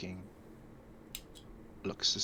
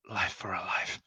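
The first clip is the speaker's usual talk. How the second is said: hushed whisper. Acted. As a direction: breathe it out as a vow. Whispered vow before the kill.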